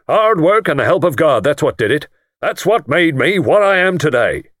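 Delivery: bumptious lord lecturing the guest; boastful self-made lord. Acted. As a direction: orate your rags-to-riches story with pride. Lord boasting of his rise.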